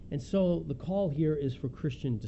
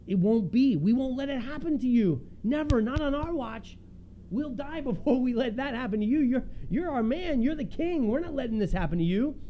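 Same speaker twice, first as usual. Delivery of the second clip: higher, urgent pleading; pleading protest; 8 semitones higher than usual. Retold or imitated. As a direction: beg him fervently not to let it happen. Disciples begging Paul not to go.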